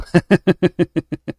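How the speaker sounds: laugh. Real, not acted. Laughs at teasing.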